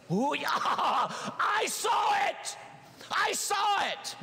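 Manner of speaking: high, slow, delighted cry with a laugh; mock amazement. Preacher mocking a believer who sees a sign. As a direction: gasp at the miracle in the sky. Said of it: "imagined believer seeing sign".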